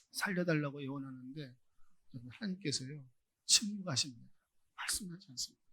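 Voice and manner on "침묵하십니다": usual speaking voice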